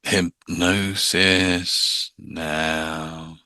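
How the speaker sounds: very slow, soft, drawn-out hypnotist drone; mock the stage hypnotist, stretching every word; cheesy hypnotist voice. Shown as a demonstration. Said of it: "we don't want to be doing the".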